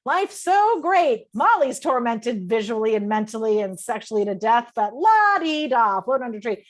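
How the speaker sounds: mock airy contentment with a bitter edge; sarcastic la-di-da. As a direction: sneer at someone floating about carefree. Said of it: "That was the energy I was sending him".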